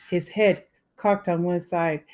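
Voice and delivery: ordinary delivery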